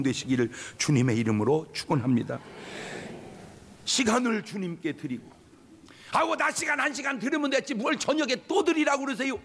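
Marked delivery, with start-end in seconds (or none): 6.1-9.4 high, whiny complaining church member; whining churchgoer. Retold or imitated. A churchgoer grumbling to the pastor about evening service, whiny and high. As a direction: moan like a member dodging evening worship.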